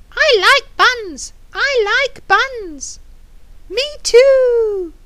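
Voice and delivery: high, eager squawky chant; excited parrot voice. Acted. Parrot chiming in about the buns, excited parrot voice. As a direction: chirp it high like a keen parrot. Excited figures at the bun race.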